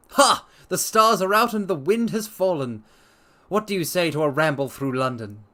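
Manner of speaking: high, loud, suddenly eager invitation; spring to life at the night air; eager Holmes. Acted. Holmes brightens, invites Watson out.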